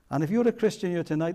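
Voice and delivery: normal talking voice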